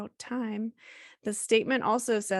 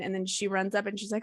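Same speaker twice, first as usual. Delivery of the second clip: ordinary talk, nothing marked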